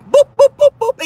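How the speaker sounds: high, loud boop boop of a phone dialing; dialing beeps. Retold or imitated. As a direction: beep like a phone keypad.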